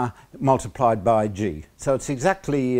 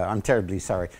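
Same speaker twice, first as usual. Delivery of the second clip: mock apology; 6 semitones lower, 4 dB quieter, faster than usual. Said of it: "Australia wins again".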